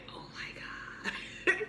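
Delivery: slow, hushed whisper; soft, drawn-out unvoiced gasp of words; mortified whisper. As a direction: whisper it in embarrassed disbelief. Embarrassed reaction, retold.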